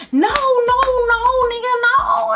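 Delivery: high-pitched rapid no no no; high frantic denial. Retold or imitated. Denying to the boyfriend, high frantic protest. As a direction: panic to shut down the accusation.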